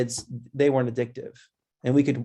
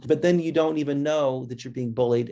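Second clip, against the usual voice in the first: normal talking voice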